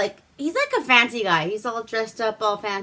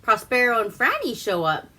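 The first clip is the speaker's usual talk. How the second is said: ordinary delivery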